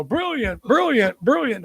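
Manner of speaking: high, lilting ad catchphrase; Guinness commercial. Retold or imitated. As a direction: toast each word with a high swoop, imitating the ad. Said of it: "like that old Guinness commercial".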